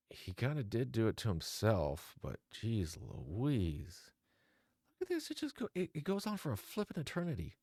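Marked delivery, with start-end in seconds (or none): 2.2-4.1 exasperated. Real, not acted. Exasperated at the texts. 5.0-7.6 much higher, exasperated; exasperated squeak. Real, not acted. Vlogger exasperated by the long message, pitched up.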